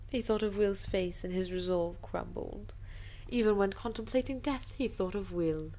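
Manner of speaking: ordinary delivery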